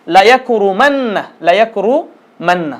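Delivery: each syllable drawn out slowly; slow model pronunciation. Shown as a demonstration. Teacher modelling the word slowly for students. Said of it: "মূলত এভাবে হবে".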